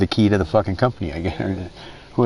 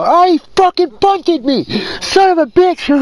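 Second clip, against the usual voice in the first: much higher, louder, slow whining impression; whiny mock friend; 5 dB louder than usual. Mocking his friend complaining about getting wrecked, whiny squeal. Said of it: "mimicking friend's whining".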